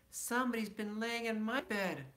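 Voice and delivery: lightly voiced middle-sized bear. Bedtime story, mama bear finding her bed messed. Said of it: "in her middle voice".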